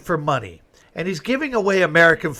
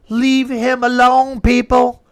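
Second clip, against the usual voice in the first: higher, slow, louder demand; slow raised scolding; indignant plea; 8 semitones higher, 3 dB louder, slower than usual. Real, not acted. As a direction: tell the crowd off firmly. Ranting at the hecklers.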